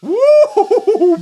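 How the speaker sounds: high, loud woo breaking into laughter; laughing whoop. Real, not acted. Full throttle hit, laughing high whoop. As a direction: whoop it out, giggling. Hard hit while flying.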